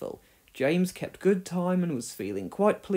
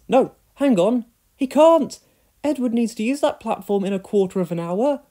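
louder, flustered signalman, a hint of panic; apologetic signalman; 6 semitones higher, 7 dB louder than usual. Signalman apologising to the crew, flustered. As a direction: fret and apologise all at once. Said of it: "He was most apologetic".

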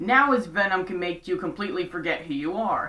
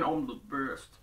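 low soft catchphrase callback; quoted catchphrase; 5 semitones lower, 9 dB quieter than usual. Retold or imitated. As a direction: recall a cartoon line. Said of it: "do any of you remember that thing?".

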